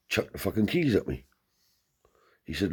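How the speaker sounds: ordinary talk, nothing marked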